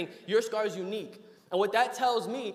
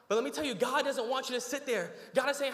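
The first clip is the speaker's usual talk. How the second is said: usual speaking voice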